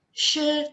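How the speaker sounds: SHE held high and level; first tone. Shown as a demonstration. Teacher leading students to repeat, high first tone. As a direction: sustain she on one high pitch. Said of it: "Yang pertama".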